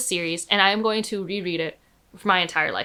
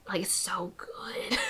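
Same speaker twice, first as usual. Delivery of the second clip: near-whisper, much softer and drawn out; hushed gushing; 13 dB quieter, slower than usual. Real, not acted. Reader gushing to viewers about a favorite book, hushed and reverent. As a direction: whisper it slowly, overcome with love for it. Gushing over the world building.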